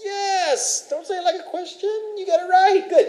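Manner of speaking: thrilled teacher confirming the answer; excited praise. Real, not acted. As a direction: cheer the student on.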